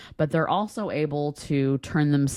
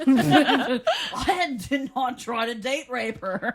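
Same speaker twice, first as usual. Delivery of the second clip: high, slow hammed-up protest; mock denial; 8 semitones higher, slower than usual. Pretend suspect denying drugging her, mock indignant. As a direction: deny it indignantly as a joke, laughing.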